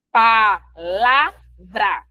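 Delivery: palavra very slowly as pa, la, vra; syllable by syllable. Shown as a demonstration. Teacher showing the three syllables, palavra slowly syllabified. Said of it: "palavra tem quantas sílabas".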